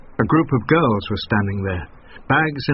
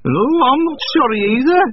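high, brassy donkey bray in the voice; 14 semitones higher, 5 dB louder, faster than usual. A narrator's reading. Donkey braying back at the son, grumpy. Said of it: "brayed the donkey".

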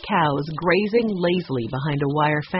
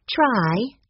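higher-pitched little plea; pleading; 6 semitones higher than usual. A narrator's reading. Girl urging her hesitant aunt, pleading. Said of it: "Violet pleaded".

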